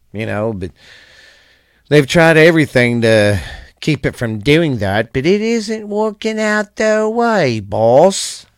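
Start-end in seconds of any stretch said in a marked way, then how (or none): 5.1-8.5 drawled sign-off. Acted. Closing line to a boss.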